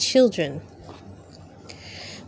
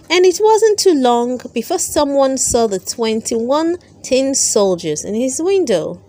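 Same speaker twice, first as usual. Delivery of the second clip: ordinary delivery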